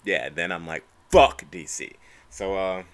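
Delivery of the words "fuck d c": loud burst on the curse; emphatic dismissal. Acted. Fan turning on his favorite fighter, loud emphatic dismissal. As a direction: punch out the swear, suddenly turning on him. Quotes own reaction when allegiance flips.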